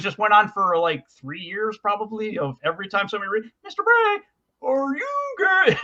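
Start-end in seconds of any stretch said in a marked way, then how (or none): 3.6-5.8 very high, slow, laughing silly voice; funny mock voice. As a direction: squeak it out slowly like a teasing schoolboy. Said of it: "in this kind of funny voice".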